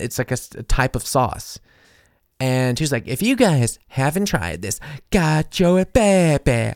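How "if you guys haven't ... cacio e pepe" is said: slow, higher, hammed-up food blogger voice; over the top. Imitating the viral food blogger, over the top. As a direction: ham it up like a TikTok influencer. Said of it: "she says it so over the top".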